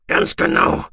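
loud, harsh angry snarl; furious retort. A narrator's reading. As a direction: throw it back at him in anger. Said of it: "entgegnete Goloschier wütend".

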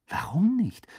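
high, swooping question; surprised. A narrator's reading. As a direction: ask it puzzled, pitch leaping up. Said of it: "fragte Hermine überrascht".

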